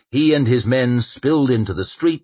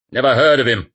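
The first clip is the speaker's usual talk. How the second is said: gruff legate; 5 semitones higher, 7 dB louder, faster than usual. A narrator's reading. Legate dismissive.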